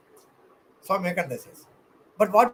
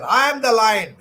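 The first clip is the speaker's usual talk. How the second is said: bold affirmation; 4 semitones higher, 7 dB louder than usual. Shown as a demonstration. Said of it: "you have to affirm the nature".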